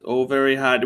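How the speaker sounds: ordinary talk, nothing marked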